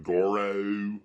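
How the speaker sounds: mock country drawl on Goro; rural accent. Mimicking rural folk naming Goro, country accent. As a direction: put on a rural twang for Goro. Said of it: "in rural parts".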